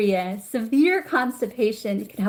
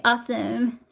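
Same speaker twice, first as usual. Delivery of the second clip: ordinary talk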